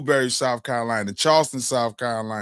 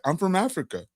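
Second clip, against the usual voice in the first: mocking evasive answer; 9 semitones higher than usual. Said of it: "I'll be like".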